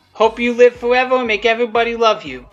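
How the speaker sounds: ordinary delivery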